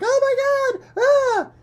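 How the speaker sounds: high terrified shriek; little creature screaming in terror; panicked scream. Acted. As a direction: shriek as the bird swoops in. Small species panics at bird.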